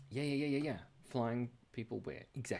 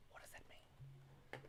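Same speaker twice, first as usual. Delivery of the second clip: whisper; 23 dB quieter, slower than usual. Real, not acted. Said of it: "whispered aside".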